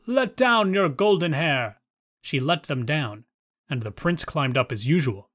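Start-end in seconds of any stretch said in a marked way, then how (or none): 0.0-1.8 calling up. A narrator's reading. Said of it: "the prince came and called out".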